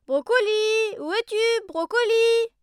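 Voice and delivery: high loud call for a lost dog. Acted.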